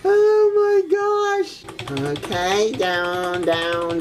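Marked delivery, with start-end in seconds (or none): 0.0-1.6 loud, high, slow cry of shock. Real, not acted. Grandma shocked by the game, a long loud high cry.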